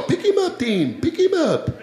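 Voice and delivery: high, urging put-on father-in-law voice. Father-in-law urging driver to stop, high mimicking voice. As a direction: imitate him insisting, starting very high. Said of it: "my father-in-law".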